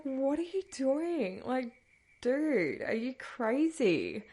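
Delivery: high, slow disbelieving questions; incredulous. Retold or imitated. Daughter confronting her mum who followed her, high incredulous.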